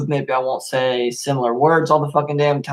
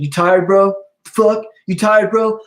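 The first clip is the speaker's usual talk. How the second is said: lightly voiced bro, higher and louder; mocking bro voice; 6 semitones higher, 5 dB louder, slower than usual. Coworker teasing him for yawning, mock bro voice. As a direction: tease like a gym bro, drawing out tired. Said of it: "someone said".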